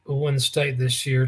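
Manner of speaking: ordinary talk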